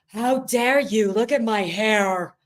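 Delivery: slow, drawn-out mock outrage; hammed-up diva indignation; 1 mock offended. Imitating Angie seeing the bonnet, slow and mock offended. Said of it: "I was dying when Angie".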